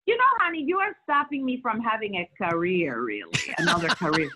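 imitating her mother, high and accented; playful mock complaint in a Dominican mother's accent. Mother teasing her daughter about losing the role, accented imitation. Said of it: "she goes".

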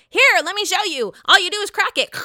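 mock know-it-all hostess; Dorinda showing off. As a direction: imitate her showing everyone how. Dorinda demonstrating lobster cracking.

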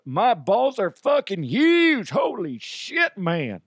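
loud comic cowboy boast; Cowboy DeHuff. Acted. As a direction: ham up the bragging cowboy. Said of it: "Cowboy DeHuff's talking".

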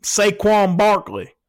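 slow, drawn-out mock Southern name; Southern accent demo. Shown as a demonstration. Host correcting co-host's impression, exaggerated Southern accent. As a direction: stretch the syllables in a Southern accent. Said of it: "no, you needed to go".

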